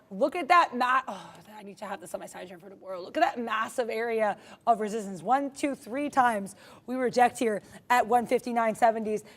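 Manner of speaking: normal talking voice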